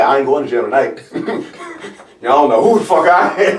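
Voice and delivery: laughing through the boast; cracking up mid-line. Retold or imitated. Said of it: "I'm like".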